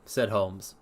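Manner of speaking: usual speaking voice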